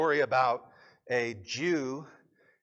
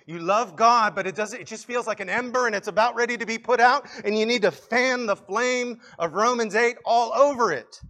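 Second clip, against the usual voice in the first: higher, urgent sermon delivery; impassioned preaching; 7 semitones higher, 8 dB louder than usual. Real, not acted. Preacher urging the discouraged to fan the flame, impassioned. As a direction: drive it home with mounting urgency.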